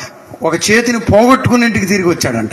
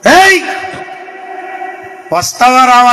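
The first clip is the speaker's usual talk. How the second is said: forceful demand; 6 semitones higher than usual. Acted. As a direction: bark it out like a stern summons. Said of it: "గట్టిగా ఎప్పుడన్నా".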